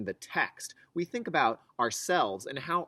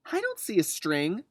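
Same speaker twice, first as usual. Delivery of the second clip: put-on higher, puzzled little boy; child voice; 7 semitones higher, 5 dB louder than usual. Jeremy doubting mom about the string, childlike voice. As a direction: say it like a doubtful kid.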